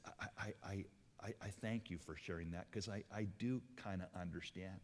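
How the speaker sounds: soft consolation to a grieving woman; gentle. Retold or imitated. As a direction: ease into it gently. Gently answering her grief.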